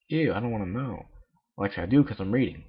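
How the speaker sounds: rattled off quickly; hurried mutter; fast aside. Real, not acted.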